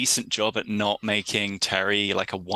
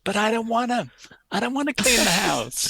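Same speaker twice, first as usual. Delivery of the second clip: put-on high whining teenager, pitched way up; whiny voice; 13 semitones higher than usual. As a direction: whine it like a sulky kid. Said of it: "in a kind of whiny, whiny voice".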